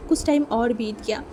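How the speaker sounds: ordinary delivery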